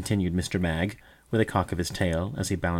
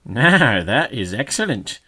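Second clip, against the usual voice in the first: mocking chuckle; 4 semitones higher, 8 dB louder, slower than usual. A narrator's reading. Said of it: "chuckling with laughter".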